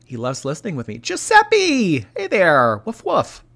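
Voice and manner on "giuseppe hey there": ordinary delivery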